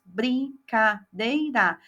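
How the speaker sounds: slow, split "Brincadeira" into its four pieces; teacher spelling out "Brincadeira" slowly; slow syllable by syllable. Shown as a demonstration. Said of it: "Ele vai colorir todos os pedacinhos".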